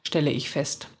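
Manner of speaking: ordinary delivery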